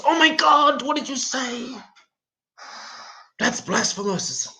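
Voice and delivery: high, shocked, scandalised voice; mock outrage. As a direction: play a horrified believer. Mock outrage at Beatles line.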